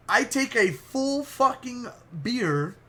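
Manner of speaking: ordinary delivery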